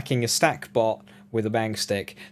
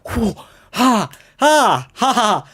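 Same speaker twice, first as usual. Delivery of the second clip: loud high ha ha ha; triumphant shouted laughs; 11 semitones higher, 7 dB louder, much slower than usual. Real, not acted. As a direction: bark out victory laughs.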